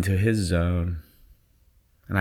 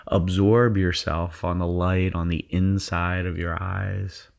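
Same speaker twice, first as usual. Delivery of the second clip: guided meditation. Acted. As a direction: ease them into their zone. Leads a guided visualization.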